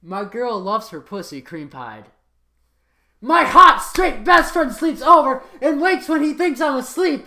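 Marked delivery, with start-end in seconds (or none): 3.2-7.2 loud high yell all through. Acted. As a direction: yell the whole title. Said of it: "It had to be yelled".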